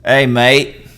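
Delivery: loud would-be British that comes out Australian; hammed-up Aussie accent, calling out. Shown as a demonstration.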